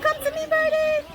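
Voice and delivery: very high, sing-song call to a bird; high cooing birdie call. Retold or imitated. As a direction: coax the bird in a sweet high voice. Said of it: "I said".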